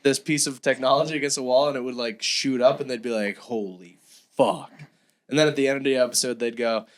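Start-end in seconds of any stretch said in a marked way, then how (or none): 3.4-4.7 low, slow, drawn-out amazement; hushed-down mock astonishment; mock awe. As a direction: imitate a stunned ghost hunter, dropped low and slow. Retold ghost hunters' awed reaction.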